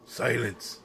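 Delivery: sudden sharp command; commanding outburst. Acted. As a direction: cut them off with a loud order. Hyde silencing someone, pitch and level jump.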